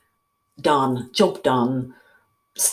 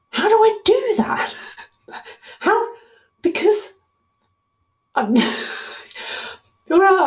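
much higher, squeaky flustered question; squeaky panic; much slower than usual. Acted. To listeners, panicking over the sample: squeaky high fluster. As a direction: squeal it like you're out of your depth.

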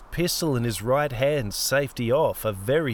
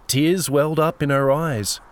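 ordinary delivery, nothing marked

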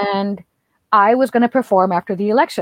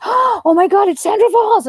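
high, loud gushing outburst; imitating an excited fan, pitched way up; starstruck fan; 9 semitones higher, 5 dB louder than usual. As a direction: gasp out delighted recognition of a celebrity. Retells a fan recognizing her.

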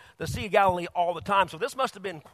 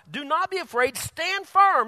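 high, loud proclamation; preaching Moses; 8 semitones higher, 7 dB louder than usual. Acted. Moses telling the people to stand firm, proclaimed high and loud. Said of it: "And he says".

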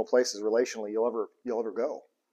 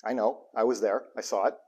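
a hint of a kind fatherly God; gentle God voice; 2 semitones lower than usual. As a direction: reassure the child kindly. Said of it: "And he'll say".

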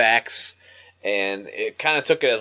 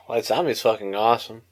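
normal talking voice

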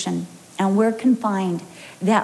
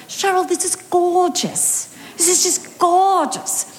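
gushing praise; 9 semitones higher, slower than usual. Retold or imitated. Women praising the coffee cake.

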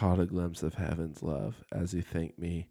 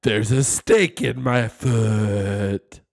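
slow, louder Woody line; pull string toy; 7 dB louder, much slower than usual. Retold or imitated. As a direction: drag out the toy's catchphrase. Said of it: "That's his classic line".

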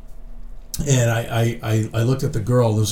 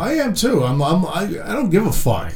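mock bravado; 7 semitones higher than usual.

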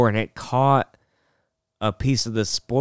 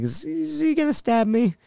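high, quiet mock nervousness; a hint of wary worry, pitched up; on edge; 12 semitones higher, 4 dB quieter than usual. Wondering about the partner after yelling, mildly on edge. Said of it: "you're kinda s-, on edge".

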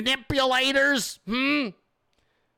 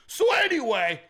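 higher, flustered defensive ramble; high panicked excuse; panicked rambling excuse; 8 semitones higher than usual. Retold or imitated. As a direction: babble your way out in a high panic. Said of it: "You're like".

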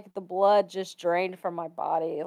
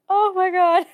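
stunned oh my God; 11 semitones higher, 5 dB louder than usual. Retold or imitated. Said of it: "I was just like".

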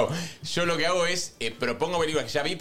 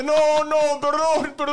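high, loud flustered sorry; suddenly caught, apologize in a rush; startled apology; 11 semitones higher, 5 dB louder, much slower than usual. Retold or imitated. After nearly hitting an old person, high flustered apology. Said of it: "y digo, ay, y digo".

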